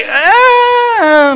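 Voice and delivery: reluctant eh. Retold or imitated. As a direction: squeal out a reluctant eh. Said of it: "we say".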